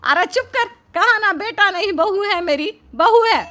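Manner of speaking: shrill-free but piercing outraged rant; angry scolding. Acted. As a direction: shout him down, outraged. Mother-in-law scolds the thief.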